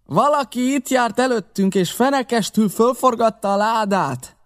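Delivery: high, carrying shout; yelled report. A narrator's reading. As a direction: holler the bad news down from a window. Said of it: "ordította".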